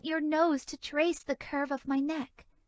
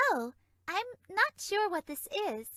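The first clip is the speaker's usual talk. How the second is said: usual speaking voice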